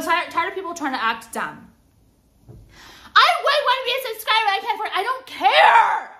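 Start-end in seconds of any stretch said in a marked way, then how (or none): 3.2-5.0 fast, very high mimicry; exaggerated pleading imitation; mocking complainer. Mimics complaining would-be subscribers. 5.0-6.2 high, loud, drawn-out dismissal; fed-up raised-voice retort; exasperated shout. Real, not acted. Snaps back at mimicked complaint.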